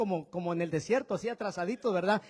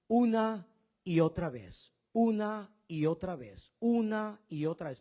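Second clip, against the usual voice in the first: 1 slow repetition. Shown as a demonstration. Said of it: "repetition to show meditating".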